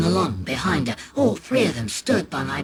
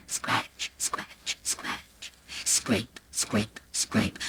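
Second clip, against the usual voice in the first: breathy whispered scratch sounds; 7 semitones lower, 9 dB quieter, much slower than usual. A narrator's reading. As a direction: whisper the scraping noises. Scratching sound effect words.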